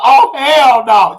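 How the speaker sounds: slow, loud and drawn out; loud, stretched refusal. Real, not acted. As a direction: scoff at the number and shout it down. Reacting to nine thousand comment.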